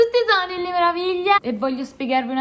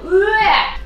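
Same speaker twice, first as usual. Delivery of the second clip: high, short noise of disgust; high-pitched comic retch; mock disgusted "bleh"; 8 semitones higher than usual. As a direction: blurt out an exaggerated yuck sound. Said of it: "Ecco cosa ne penso".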